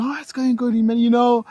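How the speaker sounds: eager reply. Retold or imitated. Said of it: "You go".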